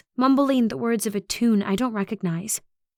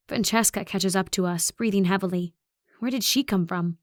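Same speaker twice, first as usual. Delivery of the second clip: plain reading voice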